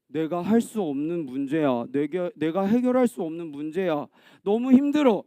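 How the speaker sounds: low, acted hopelessness; discouraged. Person facing a problem beyond them, discouraged. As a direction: give up, lightly dejected.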